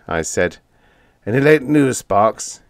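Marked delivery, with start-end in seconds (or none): 1.2-2.6 mildly anxious, pressing question; a touch of worry as he asks for news; urgent but understated; anxious demand. A narrator's reading. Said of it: "he demanded anxiously".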